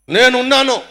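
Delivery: high, loud shout; shouted answer. Retold or imitated. Preacher mimics the congregation shouting back, loud. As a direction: yell it like the whole crowd answering. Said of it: "అని ఓ అరుస్తున్నావు కదా".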